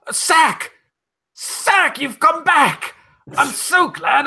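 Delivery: high, thrilled cry of relief; overjoyed. Acted. Seeing the lost sack again, high delighted cries. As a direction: greet the sack like a lost friend.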